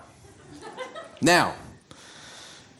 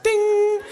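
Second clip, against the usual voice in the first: imitated news chime; 14 semitones higher than usual.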